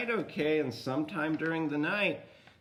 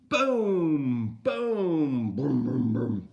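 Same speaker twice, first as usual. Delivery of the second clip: thunder booms; 5 dB louder, much slower than usual. Shown as a demonstration. Storyteller showing how thunder goes, booming imitation. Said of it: "That's how thunder goes".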